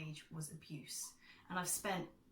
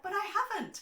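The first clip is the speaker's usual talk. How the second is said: much higher and louder, stunned; indignant protest; 9 dB louder, faster than usual. Retold or imitated. Replying to the commenter, a stunned high protest. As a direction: blurt it out in disbelief. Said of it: "And I was like".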